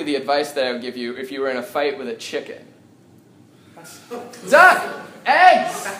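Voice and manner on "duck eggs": high, loud yell of duck and eggs; shouted punchline. Shown as a demonstration. Comic shouting the pun at the crowd, loud yell. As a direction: bark it like a warning to dodge. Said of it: "my most well-written joke".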